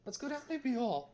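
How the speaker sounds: high, crackly little voice; crackly Adam voice. Retold or imitated. Imitating the roommate's pal stuck on the couch, high crackly little voice. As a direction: mimic a friend in a tiny, high, crackly whine. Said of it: "in his crackly little Adam voice".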